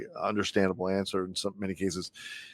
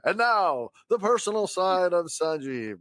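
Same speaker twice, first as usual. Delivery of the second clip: big announcer voice; 7 semitones higher than usual. Shown as a demonstration. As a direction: announce it like a big show reveal. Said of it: "a big announcer voice".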